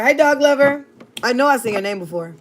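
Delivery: normal talking voice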